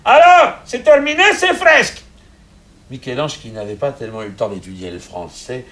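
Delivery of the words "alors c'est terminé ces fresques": high, loud shout. Acted. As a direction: shout it through the door. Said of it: "il cria".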